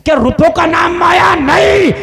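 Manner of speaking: high, full-voiced preacher's shout; shouted rhetorical question. Real, not acted. Preacher asking the crowd what wealth is, shouted denial.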